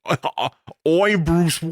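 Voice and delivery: high, badly faked Australian accent; Bruce Wayne. Trying Mister Wayne as an Australian, bad Australian accent. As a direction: fumble a hammed-up Australian voice. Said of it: "here's Mister Wayne as an Australian".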